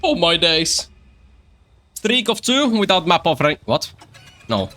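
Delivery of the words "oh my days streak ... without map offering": mock British streamer voice, pitched higher; a hammed-up British "Oh my days" intro; first take. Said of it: "Take two".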